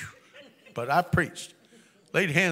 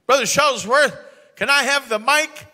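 higher, louder eager "Brother Shuttlesworth"; eager request; 8 semitones higher, 6 dB louder than usual. Retold or imitated. Excited church member asking the preacher for the microphone, higher and louder. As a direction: ask for the mic all keyed up.

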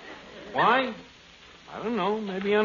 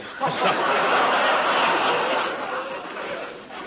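airy chuckle; breathy laugh. Real, not acted. Laughs at his own quip.

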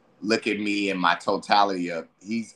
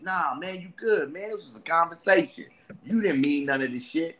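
higher, loose party-friend voice; tempting homie; 7 semitones higher than usual. Acted. Friend inviting him to get high, higher and loose. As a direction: lure your buddy out to get high. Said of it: "and they like".

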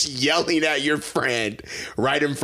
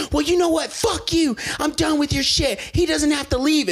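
high-pitched retold tirade; hammed-up yell of someone fed up; mock angry yelling; 12 semitones higher than usual. Girl fighting her mom at the door, mock angry yelling. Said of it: "like, yelling and screaming".

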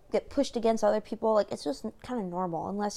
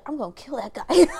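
laughing mock threat; 2 semitones higher, 8 dB louder than usual. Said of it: "He was like".

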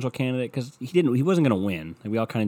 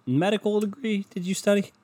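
mock questioning; 10 semitones higher than usual. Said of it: "you're like".

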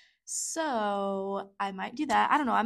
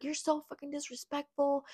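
higher, put-on voice of a mother telling her off; a hint of a scornful mother; imitating angry mom; 6 semitones higher than usual. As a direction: play the mother lashing out. Mom insulting her during quarrel.

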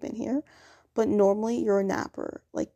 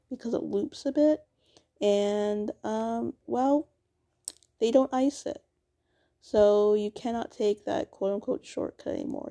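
ordinary delivery

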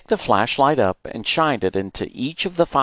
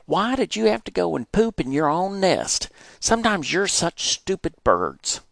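high-pitched scolding of the hens; 10 semitones higher than usual. Acted. Boy scolding hens over poop in the nest. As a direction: scold the birds, fed up. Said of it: "I yelled out loud".

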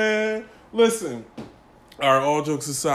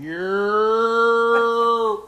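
loud stretched high yeah; hype shout; 6 semitones higher, 7 dB louder than usual. Real, not acted. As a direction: shout it out to open the show. Podcast intro hype shout.

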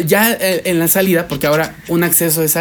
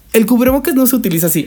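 retold angry retort; 7 semitones higher, faster than usual. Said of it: "me enojé y le dije".

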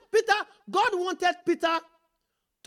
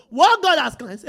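"What" lifted, the phrase slow and louder; loud slow stress on what; 8 dB louder, slower than usual. Shown as a demonstration. Preacher repeating the verse to the church, "What" stressed loud.

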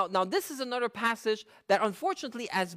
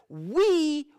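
"We" punched higher and louder; stress on we; 6 semitones higher, 4 dB louder than usual. Shown as a demonstration. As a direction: hit "We" hard. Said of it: "I emphasize certain words".

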